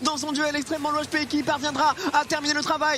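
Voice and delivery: building hype play-by-play; esports caster. Acted. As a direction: climb toward the big kill.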